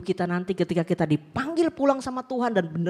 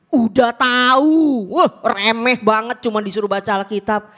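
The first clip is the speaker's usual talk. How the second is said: dismissive retort; 2 semitones higher, 8 dB louder than usual. Retold or imitated. Preacher imitating a congregant scoffing at Bible reading, mock dismissive. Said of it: "Mungkin Bapak Ibu bilang gini".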